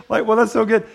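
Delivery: ordinary delivery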